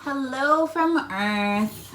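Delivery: slow drawn-out hello; family greeting. Acted. Reader voices a family calling the astronauts. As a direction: stretch it like a call from home.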